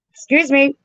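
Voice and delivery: high-pitched pretend customer; acted request. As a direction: pipe up high with a put-on question. Acts out asking for blister remedy.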